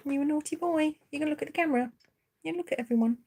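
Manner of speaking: high, cooing voice; high-pitched fond mock scolding; pet-talk. Real, not acted.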